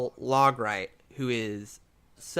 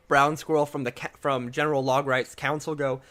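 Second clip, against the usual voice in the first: ordinary delivery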